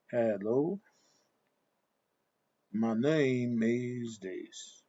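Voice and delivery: very slow Southern twang, every word stretched long; Southern accent imitation. Shown as a demonstration. Showing how southern people say hello, elongated Southern accent. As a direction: elongate each vowel in a Southern drawl. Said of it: "If it was a sudden people".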